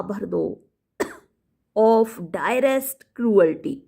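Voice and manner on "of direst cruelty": dramatic reading. Shown as a demonstration. Reading Macbeth line slowly.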